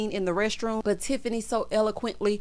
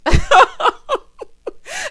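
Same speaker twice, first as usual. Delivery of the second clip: loud laugh; 10 dB louder than usual. Real, not acted. Laughing at her own joke about the TikTok, a loud burst.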